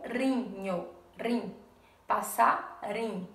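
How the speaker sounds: "rinho, rim, passarim" in pieces, RI isolated; slowly single out RI in rinho, rim, passarim; stress on RI. Shown as a demonstration. Teacher showing the strong syllable, "passarim" split with weight on RI. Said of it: "qual é a sílaba mais forte".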